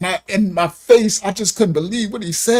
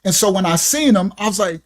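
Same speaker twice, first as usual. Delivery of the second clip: normal talking voice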